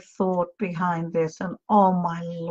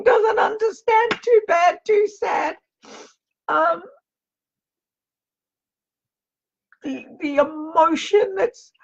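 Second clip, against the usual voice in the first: overwhelmed with emotion; 7 semitones higher than usual. Real, not acted. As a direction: pitch climbing high, voice straining with feeling. Medium overwhelmed relaying son's joy.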